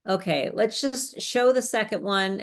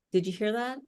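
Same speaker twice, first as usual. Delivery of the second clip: ordinary talk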